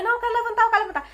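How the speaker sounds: high, pained pleading in labour; high desperate cry to stand up; desperate plea. Retold or imitated. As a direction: beg to get up, voice pushed high. Begging in labour pain.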